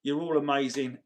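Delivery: usual speaking voice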